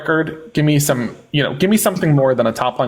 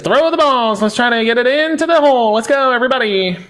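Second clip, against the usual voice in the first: carnival barker; 9 semitones higher than usual. Pretend. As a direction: shout to the fairground crowd. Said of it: "I feel like the carnival person".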